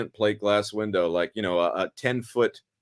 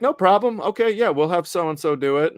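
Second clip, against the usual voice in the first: a mock-casual director, pitched up; breezy director; 7 semitones higher than usual. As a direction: toss it off like a director who doesn't care. Director's easygoing reply voiced.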